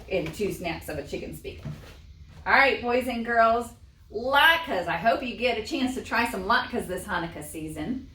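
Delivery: usual speaking voice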